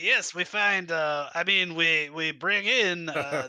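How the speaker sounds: high, eager broken-English host; dictator voice. Acted. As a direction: sell the barrel with pushy charm. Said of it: "this dictator voice".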